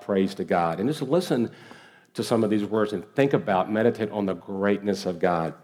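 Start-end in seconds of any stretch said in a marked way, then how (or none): none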